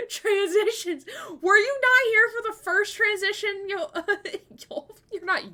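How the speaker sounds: high, loud, giggly teasing; high laughing were you not here; laughing tease. Real, not acted. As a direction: tease them through laughter. Laughing at another viewer.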